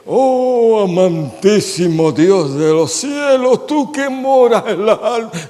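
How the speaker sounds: slow, lofty, high mock prayer voice, laughing through it; pompous prayer. Mocking showy preachers to the congregation, slow high pompous prayer voice. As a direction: ham up an ornate preacher's prayer. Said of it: "tú los mandas a orar".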